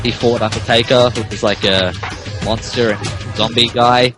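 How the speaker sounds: ordinary talk, nothing marked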